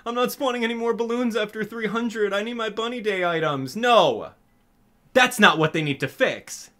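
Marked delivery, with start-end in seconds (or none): 0.1-3.8 mocking whiner. Mocking a complaining player.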